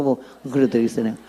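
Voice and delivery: ordinary delivery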